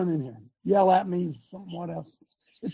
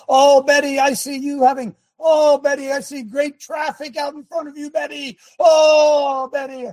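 mock fortune-teller; 6 semitones higher, 4 dB louder than usual. Preacher mimicking fake prophecy to Betty, mock fortune-teller. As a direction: wail "Oh" like a hammed-up seer. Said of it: "not telling you what's going to happen".

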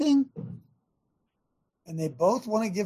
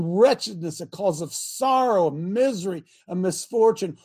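normal talking voice

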